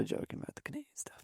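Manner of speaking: soft whispered comment; whispered aside. Real, not acted. A sly aside to the cohost, whispered. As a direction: whisper it off to the side.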